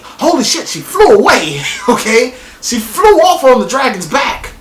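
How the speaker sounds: high, loud thrilled disbelief; holy shit, high and loud with a laugh; amazed. Real, not acted. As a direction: gush over the best moment of the episode. Amazed at Dany flying off.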